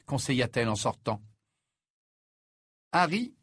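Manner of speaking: ordinary delivery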